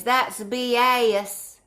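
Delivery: fond imitation of a Southern American accent. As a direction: imitate his Southern drawl, syllables pulled long. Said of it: "that nice southern accent".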